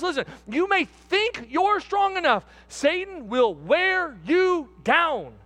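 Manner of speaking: building to high, forceful exhortation; fervent pulpit warning; impassioned preaching. Real, not acted. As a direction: preach a warning, climbing high and stretching the last words.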